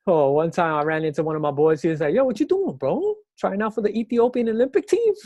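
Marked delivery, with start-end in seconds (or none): 2.1-5.1 higher-pitched mock heckle from a buddy; teasing, pitched-up impression breaking into laughter; imitated teasing friend. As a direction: rib him like a friend on the street. Imitates friend teasing him while running.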